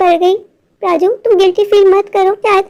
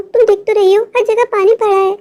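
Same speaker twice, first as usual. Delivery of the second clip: normal talking voice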